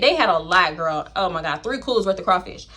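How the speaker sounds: ordinary talk, nothing marked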